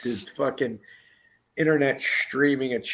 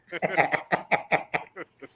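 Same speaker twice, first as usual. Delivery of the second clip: bursts of staccato laughter; helpless run of chuckles; laughing; 3 semitones higher than usual. Real, not acted. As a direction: crack up at your own joke. Cracking up at the joke.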